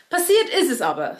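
ordinary delivery

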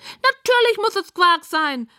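high, loud, drawn-out piggy outrage; indignant squealing pig. A narrator's reading. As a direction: protest slowly in a high pig voice. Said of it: "sagt Rosamunda entrüstet".

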